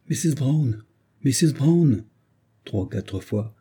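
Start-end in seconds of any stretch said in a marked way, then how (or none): 0.1-2.0 higher-pitched call for someone. A narrator's reading. Man calls for Missus Brown through an empty house, calling out. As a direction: call out for an answer, pitch raised. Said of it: "Il appela".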